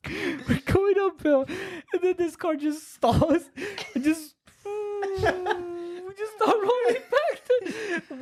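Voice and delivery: high-pitched laughing through the words; can barely get it out for laughing; laughing hard. Real, not acted. Laughing retelling stalled car.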